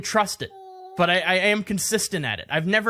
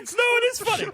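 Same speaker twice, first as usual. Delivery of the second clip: laughing; much faster than usual. Real, not acted. Laughing along.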